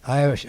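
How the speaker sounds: Irish stressed up front, then the explanation. Real, not acted. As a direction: hit the word Irish. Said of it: "I emphasize the word Irish".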